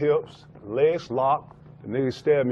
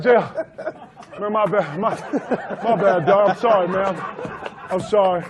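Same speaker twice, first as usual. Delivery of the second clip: higher, louder apology through laughter; laughing apology; 5 semitones higher, 4 dB louder than usual. Retold or imitated. Player apologising to teammate after Jordan's run, laughing. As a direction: sheepishly apologise while laughing. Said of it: "I was like".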